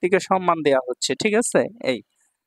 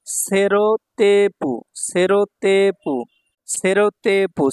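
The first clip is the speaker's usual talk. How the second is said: slow model word; much slower than usual. Shown as a demonstration. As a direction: stretch সেরোতেপু for the class. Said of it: "teacher models word slowly".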